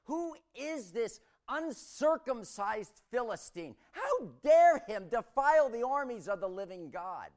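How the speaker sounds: retold battle shout. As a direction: hurl it across the valley at the giant. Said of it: "He shouted out".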